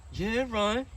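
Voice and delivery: squeaky put-on voice for a sheepish mechanic; mocking the mechanic. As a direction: mimic the mechanic's lame answer high and loud. Voicing the mechanic's reply.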